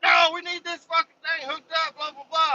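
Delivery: high whiny coworker voice; mock workers. Workers insisting he stay hooked up, high whiny voice. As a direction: whine like a nagging foreman.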